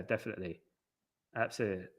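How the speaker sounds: usual speaking voice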